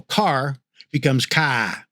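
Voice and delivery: "ka" said with no final R, ending on an open vowel; car as "ka", R dropped. Shown as a demonstration. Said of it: "which drops the R".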